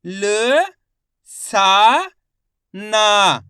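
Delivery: ल, सा, ना each loud and held alone; letter-by-letter sounding out. Shown as a demonstration. Teacher spells out a word for learners, loud isolated syllables. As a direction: punch out ल, सा, ना with pauses between. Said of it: "एक एक अक्षर का उच्चारण".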